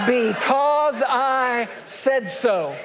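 slow, drawn-out parental shout; fed-up parent ending the argument; stern parent. Acted. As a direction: lay down the law like a fed-up parent. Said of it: "What is the answer to "but why".

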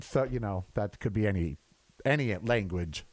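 ordinary talk

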